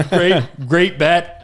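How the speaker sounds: laughing imitation of a dry retort; amused retelling. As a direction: repeat the quote while cracking up. Cracking up retelling a fighter's retort.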